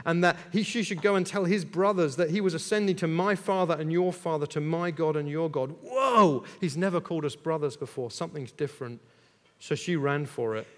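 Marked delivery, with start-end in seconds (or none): none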